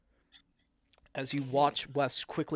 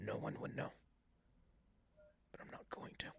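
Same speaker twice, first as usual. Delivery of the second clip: menacing whisper; 3 semitones lower, 9 dB quieter than usual. Acted. Mayor threatening the beaten party, low whisper. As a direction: breathe the threat softly, then hold back. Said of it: "and he's like".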